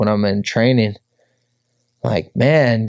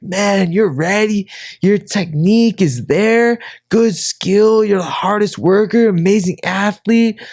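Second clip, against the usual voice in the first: coach impression; 9 semitones higher than usual. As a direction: lay on the praise like a proud coach. Coach Felipe praising him.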